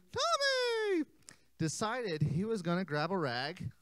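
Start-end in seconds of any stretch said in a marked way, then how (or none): 0.1-1.0 high called name. Shown as a demonstration. Cueing kids to shout name.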